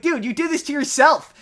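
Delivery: loud, high, incredulous scolding; scolding a character who brought it on himself; exasperated scolding. Retold or imitated. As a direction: tell him off, he earned it.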